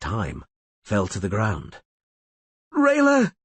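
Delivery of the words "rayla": high, loud dying cry; scream. A narrator's reading. Dying Vilis screaming to Rayla for help. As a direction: scream her name in agony. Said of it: "he screamed indistinctly".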